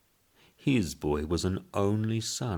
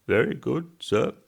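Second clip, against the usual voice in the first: higher servile reply; messenger voice; 8 semitones higher, 5 dB louder than usual. Acted. Messenger answering the boss, higher deferential.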